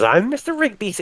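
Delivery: put-on high-pitched, squeaky drunk introduction; tipsy Mister Rigby. Game master greets a player as drunk Mister Rigby, high and silly.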